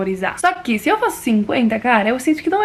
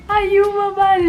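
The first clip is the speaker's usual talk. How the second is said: excited discovery; 8 semitones higher, much slower than usual. Shown as a demonstration.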